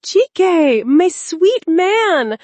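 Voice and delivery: high, loud, drawn-out squeal of delight; squealing with excitement. A narrator's reading. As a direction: squeal his name like a thrilled lover. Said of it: "Amara squealed with excitement".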